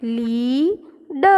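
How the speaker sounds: very slow લી, pause, then ડ; blended reading. Shown as a demonstration. Teacher showing children how it reads, slowly sounded out. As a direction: draw out લી, then add a separate ડ. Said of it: "તો વાંચન થશે".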